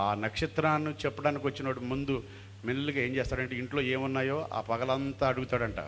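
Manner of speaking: ordinary delivery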